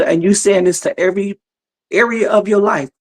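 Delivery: normal talking voice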